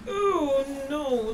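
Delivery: high, loud frightened cry; scared cry. Real, not acted. Attacked in horror game.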